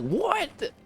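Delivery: shocked, high-pitched disbelief; surprised. Retold or imitated. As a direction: jolt up in pitch, then drag it out. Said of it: "And I was like".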